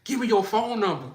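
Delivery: mock bossy order; bold demand. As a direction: demand it with no shyness. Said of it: "they be like".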